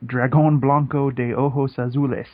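clumsy American-accented Spanish; Spanish spoken with a poor accent; terrible Spanish pronunciation. Shown as a demonstration. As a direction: read the Spanish name carefully in an English-speaker's accent. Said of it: "that was terrible pronunciation".

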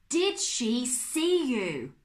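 loud, high and very slow alarm; a panicked uncle bellowing the question; alarmed outburst. Acted. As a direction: drag out each word in horror. Uncle Max alarmed at danger.

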